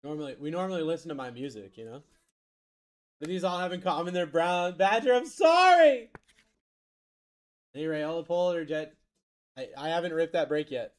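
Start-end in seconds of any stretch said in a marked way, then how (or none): 4.8-6.1 high, drawn-out shouted apology; wailed apology at full volume; loud mock apology. Real, not acted. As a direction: cry out an over-the-top apology, slow and high. Mock apology called out to Badger.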